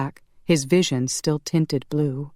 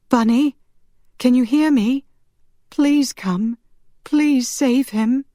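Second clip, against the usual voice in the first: high, loud, frantic calling; desperate plea; 10 semitones higher, 5 dB louder than usual. A narrator's reading. Marielle calls for help to save him, desperate plea. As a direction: beg for help at a high pitch. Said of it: "She repeated her plea over and over".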